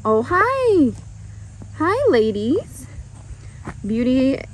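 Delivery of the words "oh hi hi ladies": high, slow cooing hello to the mares; bright greeting. Real, not acted. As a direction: coo a high hello to the horses.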